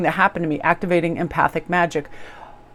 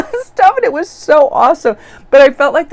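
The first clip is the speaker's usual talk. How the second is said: high, loud burst of delight; excited gushing; 9 semitones higher, 8 dB louder than usual. Real, not acted. Vlogger telling viewers about the salon visit, gushing excitedly. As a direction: gush with real delight.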